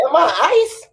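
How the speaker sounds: high mouse voice; squeaky mouse. Retold or imitated. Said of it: "The mouse said".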